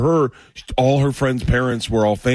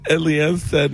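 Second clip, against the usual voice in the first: normal talking voice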